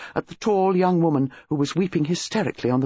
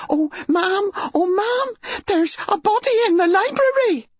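high, breathless panic; breathless, hysterical; 4 dB louder than usual. A narrator's reading. Maid bursting in to tell her mistress, hysterical and breathless. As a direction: gasp it out in high hysterical alarm. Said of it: "breathless, hysterical".

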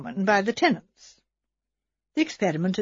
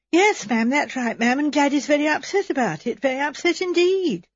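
higher, hurried protesting maid; flustered maid; 5 semitones higher than usual. Acted. Maid Edna assuring Miss Marple her cousin is innocent, higher and flustered. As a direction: rush to defend her cousin.

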